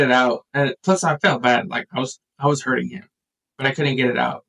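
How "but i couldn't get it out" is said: usual speaking voice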